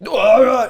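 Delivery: loud, high wordless mock yell; imitated angry yell. Comic imitating heckler getting up, loud wordless yell. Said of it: "just got up and started yelling".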